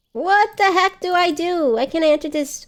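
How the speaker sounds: high-pitched exasperated complaint; frustrated outburst. Real, not acted. Streamer cannot get through a game entrance, frustrated outburst. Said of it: "I got angry".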